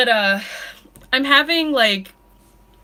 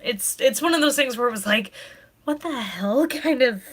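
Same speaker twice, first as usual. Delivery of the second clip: crying quietly through the words; 4 dB quieter than usual. Real, not acted. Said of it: "I'm sorry for crying".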